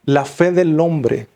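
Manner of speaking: drawn-out, deliberate preaching emphasis; slow it right down so every word lands; slow emphatic. Acted. Preacher to the congregation, slow and weighty. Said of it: "escuche bien".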